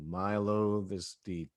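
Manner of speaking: ordinary talk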